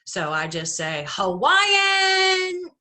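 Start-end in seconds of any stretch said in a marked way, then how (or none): 1.1-2.7 high, loud yelled clue word; shouted clue. Retold or imitated. Game clue yelled at partner Steven, a high loud shout. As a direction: blurt it out at full volume.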